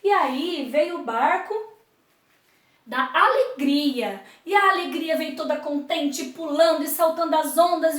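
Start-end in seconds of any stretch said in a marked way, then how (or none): none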